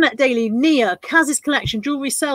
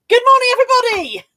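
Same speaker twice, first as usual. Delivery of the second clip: calling out; 12 semitones higher, 5 dB louder, faster than usual. Real, not acted. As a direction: sing out a big rousing hello. Greets whole audience after long roll-call.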